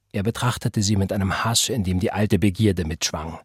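ordinary delivery, nothing marked

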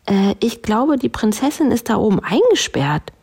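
high-pitched, hesitant-starting bear; earnest bear. A narrator's reading. Bear explaining to the others, high earnest voice. Bear figure speaks in story.

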